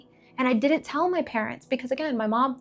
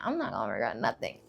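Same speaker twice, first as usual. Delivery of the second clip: low defiant; 12 semitones lower, 8 dB quieter than usual. Retold or imitated. Defiant reply to father.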